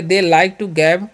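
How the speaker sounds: very slow, word by word; slow classroom dictation; slow example sentence. Shown as a demonstration. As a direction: spell it out slowly for the class. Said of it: "sentence बनाना चाहेंगे".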